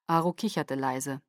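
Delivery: ordinary delivery, nothing marked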